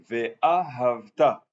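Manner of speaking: Ve'ahavta drawn out very slowly, syllable by syllable; correct stress. Shown as a demonstration. Teacher to students, modelling the correct reading, very slow Ve'ahavta. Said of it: "l'accent tonique sur le Ve'a et ensuite".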